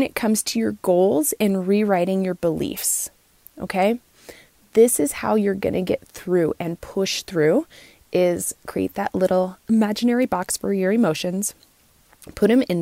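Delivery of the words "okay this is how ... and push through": ordinary delivery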